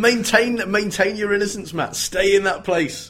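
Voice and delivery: high, emphatic mock-dramatic pleading; mock urgent plea. Friend pleading to keep childhood innocence, mock urgent.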